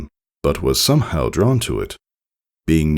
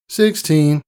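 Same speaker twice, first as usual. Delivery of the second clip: bored answer; 12 semitones higher than usual. Acted. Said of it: "with no particular interest".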